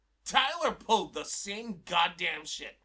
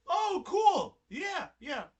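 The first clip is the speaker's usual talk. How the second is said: high, loud opening, then easing; mock-excited, pitched way up; imitated eagerness; 11 semitones higher, 5 dB louder, much slower than usual. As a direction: mimic an over-keen reply. Acting out Tyler's eager reply.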